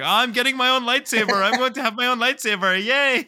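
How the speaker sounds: high-pitched mock child glee; giddy, high kid voice; excited kid. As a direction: imitate a ten-year-old bragging at school. Excited kid telling school.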